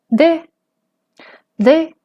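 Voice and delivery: high-tone de in smooth modal voice; high-tone de without glottalization. Shown as a demonstration. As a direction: let de ring freely, no catch.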